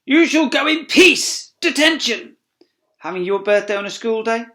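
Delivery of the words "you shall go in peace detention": high, loud, slow bellowed proclamation; shouting. Acted. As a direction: roar it like a decree. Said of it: "Before shouting".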